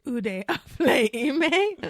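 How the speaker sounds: laughing lover line. Acted. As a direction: ham up the lover's question. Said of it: "he delivers that line to me".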